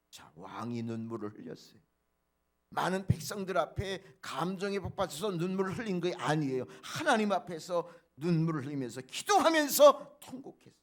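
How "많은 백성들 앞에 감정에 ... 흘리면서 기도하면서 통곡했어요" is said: louder and louder, peaking high on 통곡했어요; preaching at full force. Acted. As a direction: build to a shouted climax. Preacher climaxing on King Hezekiah. (점점 크게 외치는 설교)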